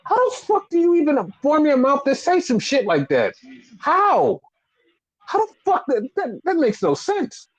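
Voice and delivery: a near-cry of outrage, pitch climbing; exasperated disbelief. Real, not acted. Disbelief at the promo again.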